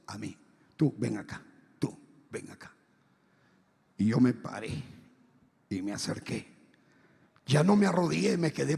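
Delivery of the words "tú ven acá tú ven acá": very low, soft, menacing summons; Sarabanda. Retold or imitated. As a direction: beckon like a dark spirit, sinking almost to nothing. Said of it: "Y Sarabanda le dice".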